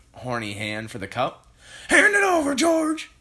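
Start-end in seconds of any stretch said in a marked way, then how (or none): 1.9-3.1 put-on very loud, high old-hag demand; Grandma demanding. A narrator's reading. Grandma ordering George, loud high hag voice. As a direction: bark the order like a crone. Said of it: "Grandma demanding".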